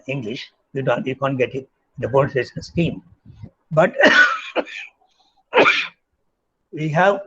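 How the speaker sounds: ordinary talk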